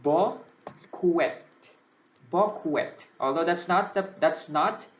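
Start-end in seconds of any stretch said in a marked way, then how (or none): 0.1-1.4 boquet as slow bo-KWET, final t sounded; literal spelling pronunciation. Shown as a demonstration. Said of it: "emphasize the spelling. Literally". 2.3-2.9 boquet as bo-KWET with a hard final t; literal spelling pronunciation. Shown as a demonstration. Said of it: "by any means the pronunciation".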